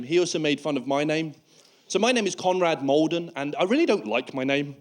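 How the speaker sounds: ordinary talk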